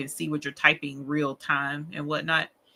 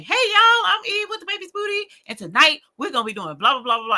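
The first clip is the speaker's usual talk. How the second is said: high, loud host greeting; chatty show intro; 13 semitones higher, 5 dB louder than usual. Acted. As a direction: act out chattering away to camera, oblivious. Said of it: "I'll be just talking away".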